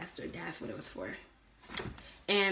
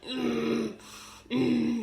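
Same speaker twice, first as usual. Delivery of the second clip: short higher-pitched strained grunts; fake straining sound; 4 semitones higher, 3 dB louder than usual. Her unable to feel herself pushing, mock straining. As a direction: imitate pushing with closed-mouth grunts. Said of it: "I'm just making a sound".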